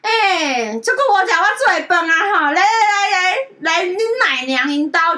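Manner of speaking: high, loud, drawn-out coaxing auntie; showy nanny. Retold or imitated. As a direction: play the old nursemaid herding a child along. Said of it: "卖弄她那个奶妈的那个姿态". (学奶妈高声拖腔地哄人)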